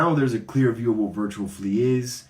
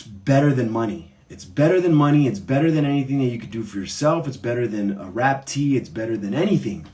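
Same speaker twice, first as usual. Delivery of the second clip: impassioned; 2 semitones higher, 5 dB louder than usual. Real, not acted. Host to viewers, swept up in feeling, passionate and loud. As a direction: pour real conviction into it.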